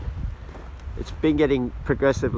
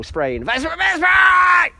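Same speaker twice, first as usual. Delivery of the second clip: high joking yell; joking shout; 5 dB louder than usual. Real, not acted. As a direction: holler a jokey threat. Joking threat to the birds.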